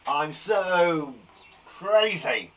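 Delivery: hammed-up angry outburst; mock anger. Shown as a demonstration. Teacher testing a synonym for angry, mock angry.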